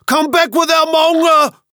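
high, loud angry yell; shouting. Acted. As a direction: call after the thieves angrily. Cried the villagers.

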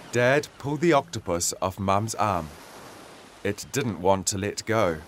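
ordinary delivery, nothing marked

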